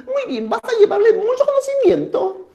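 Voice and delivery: very high put-on deity voice; high, theatrical question to an envoy; moon questioning. Moon checks on Takainamo's mission, very high voice.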